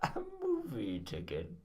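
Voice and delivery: high, slow, drawn-out put-on voice; Tim Curry voice. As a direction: stretch each word in a hammy celebrity voice. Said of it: "I'm Tim Curry".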